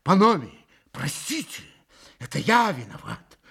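flustered, hasty-hearted apology, faltering then rising; испуганно. A narrator's reading. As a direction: plead pardon in mild alarm. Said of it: "Митя страшно испугался". (испуганно оправдываясь)

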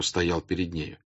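plain reading voice

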